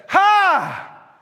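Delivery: sudden high, loud "Ha!"; startling pounce shout. Acted. Wife leaps out at drunk husband, startling shout. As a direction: jump out and scare him with a shout.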